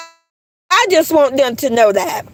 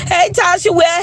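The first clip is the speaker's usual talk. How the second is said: normal talking voice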